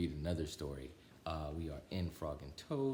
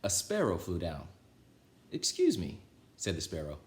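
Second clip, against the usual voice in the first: put-on lively, louder read-aloud voice for children; storybook reading; 4 semitones higher, 5 dB louder than usual. A narrator's reading. Reader telling Frog and Toad to children, lively storybook voice. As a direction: read it like bedtime story time for kids.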